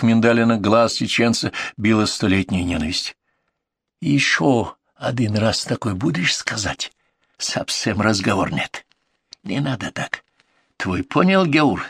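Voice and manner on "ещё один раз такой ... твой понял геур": put-on menacing broken Russian with highlander accent; sinister Caucasian accent. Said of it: "зловеще усмехнулся Джамал".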